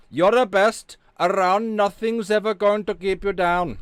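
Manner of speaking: high, loud put-on Indian accent. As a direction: belt it out in a mock Indian accent. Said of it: "you appreciate that Indian accent there".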